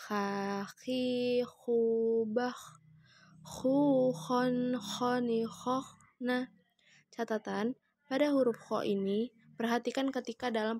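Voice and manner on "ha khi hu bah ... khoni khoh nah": "khon, khoni, khoh" mengalun dengan kh mendengkur; ayun dan tahan. Shown as a demonstration. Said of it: "Kita gabungkan dengan ayun-ayun mana tahan". (kh bergesek dan dengung ditahan pada "khon")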